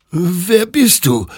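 high, drawn-out frightened whimper; high slow whimper. A narrator's reading. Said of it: "wimmerte Hector".

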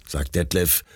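plain reading voice

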